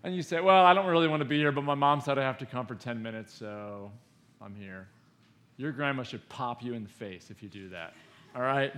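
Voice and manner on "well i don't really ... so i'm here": grudgingly explain, then trail off; mock unwilling visitor, fading away; reluctant grandchild.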